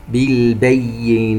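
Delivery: "بالبينات" slowly with the doubled yā held clearly. Shown as a demonstration. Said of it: "ونوضح الياء المشددة". ("بالبينات" مع توضيح الياء المشددة)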